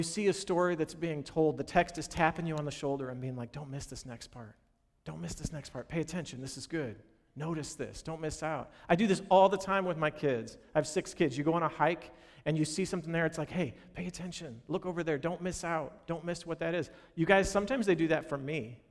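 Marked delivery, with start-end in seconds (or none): 3.5-8.7 nudging aside. Pretend. Text tapping your shoulder. 13.5-16.9 pointing aside. Retold or imitated. Dad pointing things out on a hike.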